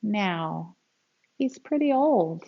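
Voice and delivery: put-on lively, expressive read-aloud for kids; storybook reading. Teacher reading a grandpa story to children, expressive read-aloud. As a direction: read it like a picture book to a class.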